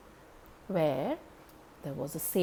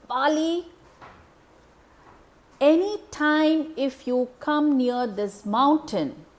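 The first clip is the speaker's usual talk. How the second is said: slow, louder stern curse; angry curse; 7 semitones higher, 7 dB louder, slower than usual. Acted. Angry sage Matanga cursing Bali, slow stern voice. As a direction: lay down the curse slowly. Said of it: "he got very angry and he said".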